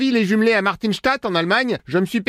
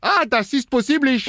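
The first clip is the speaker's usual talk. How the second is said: put-on hammy German accent, pitched higher; fake German accent; 5 semitones higher than usual. Prank caller posing as German brother-in-law, hammy German accent. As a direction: lay on a cheesy German accent in French. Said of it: "l'accent pourri".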